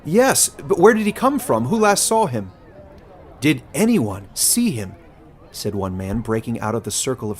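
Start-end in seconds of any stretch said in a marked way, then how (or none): 0.1-5.0 high, loud, urgent crowd voices; panicked townsfolk talking over each other; alarmed villagers all asking at once; frantic villagers. Acted.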